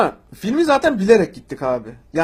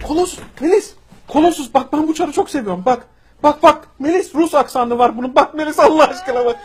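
excited fan; 7 semitones higher, 5 dB louder than usual. Acted. Excited fan shows friend Colossus.

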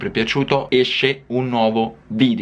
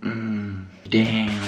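ordinary talk

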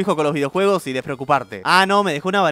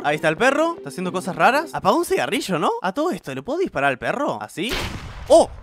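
ordinary talk, nothing marked

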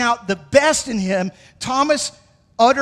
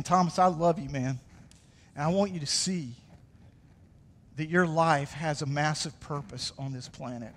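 softer and lower, tender reassurance; tender Jesus voice; 6 semitones lower, 8 dB quieter than usual. Retold or imitated. Jesus reassuring doubting Thomas, soft tender voice. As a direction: speak gently, like a loving friend. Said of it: "Jesus saying".

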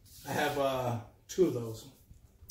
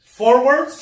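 loud; 9 semitones higher, 15 dB louder than usual. Real, not acted. Can't hear, headphones on.

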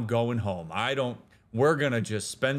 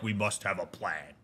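lower, softer, a hint of solemn resolve; mock serious planner; 6 semitones lower, 6 dB quieter than usual. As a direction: play it grave and dutiful, lightly. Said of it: "Instead of, like".

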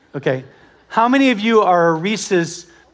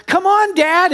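put-on high, loud coaxing child voice; kids coaxing; 11 semitones higher, 4 dB louder, slower than usual. Kids in the water calling dad to jump, high coaxing voice. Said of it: "they're like".

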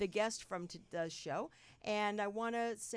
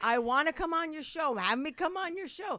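higher-pitched imitation of a pushy whiner; mock kvetching; 7 semitones higher, 5 dB louder than usual. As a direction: badger and kvetch, imitating her nagging. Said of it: "This woman has been kvetching".

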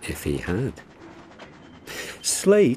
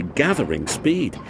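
usual speaking voice

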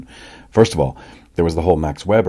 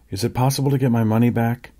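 tense crackling voice; 6 semitones higher than usual. A narrator's reading. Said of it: "in a voice crackling with tension".

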